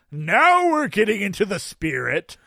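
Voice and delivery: loud, high, hearty delight; exuberant Santa. A narrator's reading. Santa delighted at the angry man, exuberant and loud. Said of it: "lets out a tremendous laugh".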